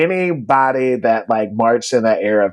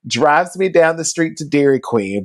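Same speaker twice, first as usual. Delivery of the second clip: ordinary talk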